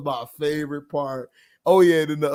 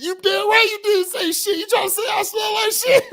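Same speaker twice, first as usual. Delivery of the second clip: higher, shouted, mock offended; mock indignant shout; 14 semitones higher than usual. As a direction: fling it back like an insulted man. Acted indignant movie quote.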